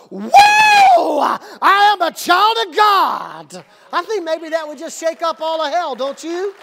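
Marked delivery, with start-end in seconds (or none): 0.1-3.6 huge high whoop, then slow loud proclamation; exuberant, drawn-out high shout; wrestler-style declaration. Shown as a demonstration. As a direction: shout it out like a wrestler entering the stadium. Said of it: "we need to walk out and go".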